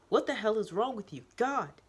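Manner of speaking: loud, high, furious outburst; angry shout. Acted. The teacher shouting at the student. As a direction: yell at her in shock.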